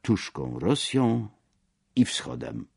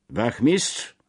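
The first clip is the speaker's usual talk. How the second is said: calling out; 6 semitones higher than usual. A narrator's reading. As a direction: call the sergeant over sharply. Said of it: "zawołał pułkownik".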